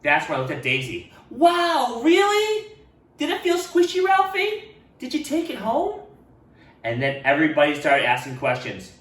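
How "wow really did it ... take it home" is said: much higher, louder, eager; excited girl. Acted. Daisy eagerly questioning Ralph, high and loud. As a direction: gush with wonder.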